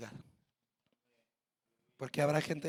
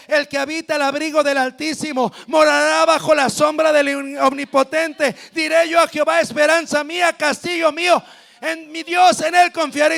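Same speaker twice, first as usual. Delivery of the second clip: loud psalm recitation; 4 semitones higher, 9 dB louder than usual. Acted. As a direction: declaim the psalm, louder. Recita el Salmo 91. (recitación del salmo en voz alta)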